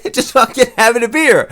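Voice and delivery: high put-on voice, altered timbre; hammed-up happy-go-lucky impersonation; mock happy fighter. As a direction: playfully imitate a fighter thrilled just to be included. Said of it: "not make this fucking face".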